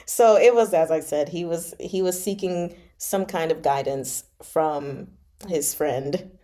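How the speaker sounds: ordinary delivery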